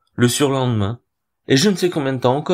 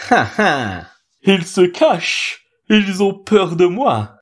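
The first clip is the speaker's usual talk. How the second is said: high, slow, sneering owl voice; gloating owl sneer; 7 semitones higher, 3 dB louder, slower than usual. A narrator's reading. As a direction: cackle it out, drawn-out and high. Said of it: "ricanait-il".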